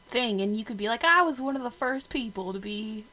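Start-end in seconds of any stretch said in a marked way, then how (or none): 1.0-3.0 put-on a light country accent, louder. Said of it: "I'm talking in a country accent".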